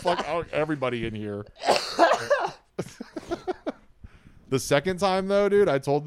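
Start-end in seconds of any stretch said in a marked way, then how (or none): 0.1-3.1 laughing. Real, not acted. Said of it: "still laughing at the story".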